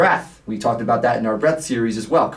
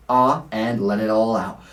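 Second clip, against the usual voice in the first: ordinary delivery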